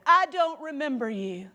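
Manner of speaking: high and loud, blankly insisting; a high-pitched, vacant brush-off; high, dazed Marie voice. Retold or imitated. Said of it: "And she said".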